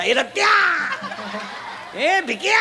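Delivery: high call with the name stretched out; mock drunken hollering. Drunk pals calling each other by their fathers' names, high mock hollering. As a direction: holler each name upward as if across a street. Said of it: "एकमेकाला बापाच्या नावानं हाका मारायचा".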